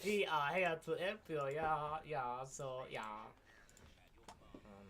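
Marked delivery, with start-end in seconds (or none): 0.0-3.0 loud, high hammed-up German accent; mock German accent. Teasing friend's inspector impression, loud mock German accent. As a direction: echo the line in an over-the-top German accent. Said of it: "Oh, sorry, that was German".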